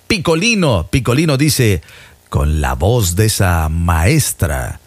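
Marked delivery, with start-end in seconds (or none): 2.3-4.8 voz muy grave y lenta, insinuante; insinuación pícara. Acted. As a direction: lee el piropo arrastrándolo, en tono bajo y sugerente. Lee un piropo de doble sentido. (voz grave, lenta y pícara)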